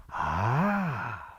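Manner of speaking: drawn-out "ah" gliding up and down; a long, knowing "ah"; old wise woman. Acted. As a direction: stretch the "ah" like a seer studying bones. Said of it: "and then she went".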